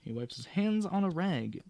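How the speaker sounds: projected storytelling voice; story narration. Acted. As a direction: read it out like a game master setting the scene. Reads the adventure text aloud.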